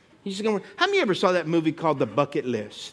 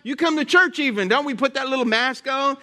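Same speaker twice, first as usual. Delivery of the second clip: ordinary talk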